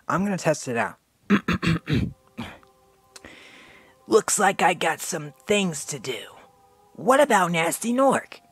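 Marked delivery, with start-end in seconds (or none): 4.1-8.4 higher, lightly voiced young dragon; Spyro voice. Shown as a demonstration. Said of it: "I'm voicing Spyro with his original voice".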